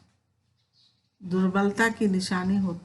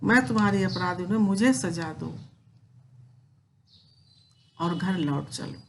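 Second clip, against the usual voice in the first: poem recitation. Acted. Teacher reciting Kaikeyi's plea to Ram, measured poem recitation. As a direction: recite the verse with feeling, slightly raised.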